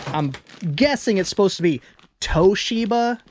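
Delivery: normal talking voice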